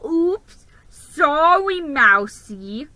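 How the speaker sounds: a touch of smug mockery; snide fake apology. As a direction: apologise without meaning it. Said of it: "He snickered".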